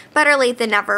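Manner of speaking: ordinary talk, nothing marked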